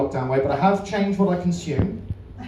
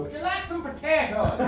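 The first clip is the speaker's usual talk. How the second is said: mocking punter; 10 semitones higher, 6 dB quieter than usual. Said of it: "come up to me at the bar ... and go".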